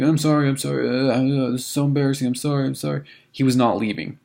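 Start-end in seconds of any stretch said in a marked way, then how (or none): 0.0-3.0 put-on slurring drunk guy. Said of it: "his words were a little slurred".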